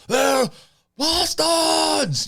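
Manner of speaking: high, full-voiced imitated scream; acted-out outraged shriek; screaming accusation. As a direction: yell it out for the whole hall to hear. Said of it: "Vaemon starts screaming and yelling".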